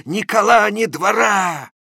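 high, loud, slow angry interrogation; shaking with anger, demanding an answer; furious scolding. A narrator's reading. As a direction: hammer him with questions in rage. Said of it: "весь дрожа от досады и бешенства".

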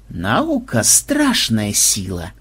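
low, slow menacing line; sinister smirk. Acted. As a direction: smirk it like a mad scientist. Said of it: "зловеще усмехнулся Ксенобайт". (зловещая усмешка)

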